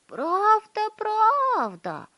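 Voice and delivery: high loud eager cry; excited shout. A narrator's reading. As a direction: shout it out together. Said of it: "хором закричали остальные старики".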